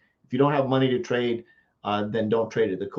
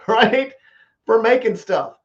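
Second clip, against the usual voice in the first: laughing; 10 semitones higher, 7 dB louder, slower than usual. Real, not acted. Laughs at own tool collection.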